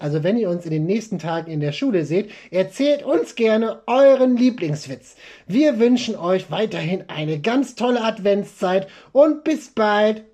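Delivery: normal talking voice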